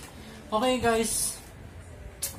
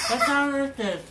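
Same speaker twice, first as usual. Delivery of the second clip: laughing while asking; words through a laugh; 7 semitones higher, 5 dB louder than usual. Real, not acted. Laughing mid-game.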